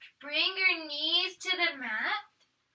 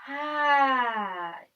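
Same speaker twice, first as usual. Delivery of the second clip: sigh. Shown as a demonstration. Breath count in yoga.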